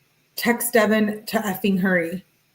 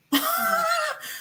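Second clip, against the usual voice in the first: real laugh; 5 dB quieter than usual. Real, not acted. Laughs at her own misreading.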